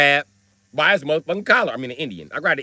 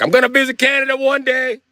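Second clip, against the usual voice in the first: high, louder caricature cop voice; 8 semitones higher, 4 dB louder than usual. Retold or imitated.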